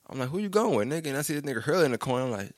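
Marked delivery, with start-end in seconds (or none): 0.3-1.1 retold high-pitched challenge, falling at the end; incredulous questioning. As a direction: act out a disbelieving question to a friend. Retold questioning of friend's companion.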